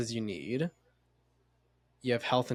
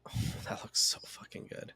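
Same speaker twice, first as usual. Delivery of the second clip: whispered craving; 7 dB quieter than usual. Real, not acted. Craves the stoner snack.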